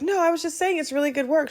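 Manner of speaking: high, sweet-talking backpedal; placating. Retold or imitated. Customer reassuring offended nail tech, placating. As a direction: keep it high and smooth things over.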